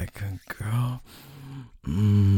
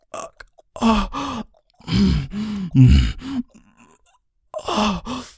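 high, strained moaning; climax moans; much slower than usual. Acted. Man reaching climax with his partner, high strained moans. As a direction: let the moans climb high and break.

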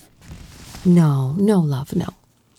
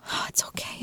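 hushed soothing whisper; whispered comfort; 11 dB quieter, faster than usual. Acted. Soothing a tearful partner, whispered softly. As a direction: breathe the words to calm a crying partner.

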